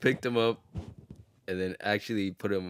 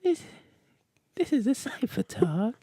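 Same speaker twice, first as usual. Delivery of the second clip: [name] voice; 14 semitones higher than usual. Retold or imitated. Closing the podcast on request, high falsetto [name]. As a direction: announce the show name in a high pop-star falsetto. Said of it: "lead us out with that voice".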